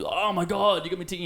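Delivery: high, thrilled gasp of surprise; excited squeal. Retold or imitated. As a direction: gush like you just got tickets. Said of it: "I was like".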